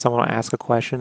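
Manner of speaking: usual speaking voice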